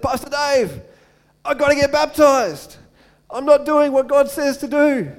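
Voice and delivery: loud, high, breathless urgency; excited urgent plea. Retold or imitated. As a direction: blurt it out like you just realised. Retold late-night call to pastor.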